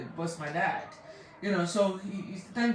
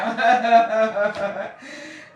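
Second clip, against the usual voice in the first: happy laugh; 7 dB louder than usual. Real, not acted. Laughs greeting her daddy.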